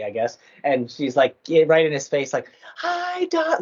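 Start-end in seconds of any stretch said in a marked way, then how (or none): 2.8-3.6 high, exaggerated shouted greeting; mock yell. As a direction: overloud greeting right in his face. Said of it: "just yelling".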